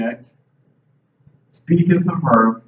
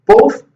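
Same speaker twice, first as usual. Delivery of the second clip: both jumps up high and loud; stress on both; 8 dB louder than usual. Real, not acted. Teacher stressing both to her ESL class. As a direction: punch the word both hard.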